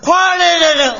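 loud, high burst to get going; eager shout. Acted. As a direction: shout to start the show at last. Performer eager to start singing.

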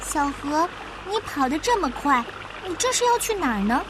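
put-on high, curious young turtle; little turtle child. Little turtle asks the running river where it goes, high and curious. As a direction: pitch it up like an inquisitive child.

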